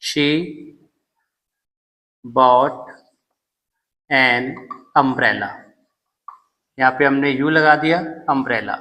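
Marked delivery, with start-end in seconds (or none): 0.0-5.5 pauses between "she", "bought", "an", "umbrella"; slow dictation for writing; dictated word by word. Shown as a demonstration. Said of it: "एक और example देखिए". (शब्द-दर-शब्द लिखवाना)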